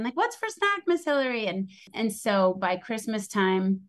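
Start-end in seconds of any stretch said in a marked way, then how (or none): 0.1-1.5 put-on high, sing-song kid's call; excited kid. Kids jumping off stairs calling to Hillary, high childish call. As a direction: chirp it like an eager child. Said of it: "kids doing daffies off the stairs saying".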